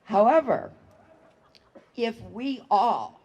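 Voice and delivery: usual speaking voice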